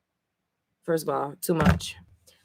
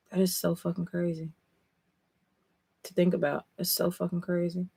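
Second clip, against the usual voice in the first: hushed amazement; 10 dB quieter than usual. Real, not acted.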